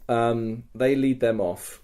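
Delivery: normal talking voice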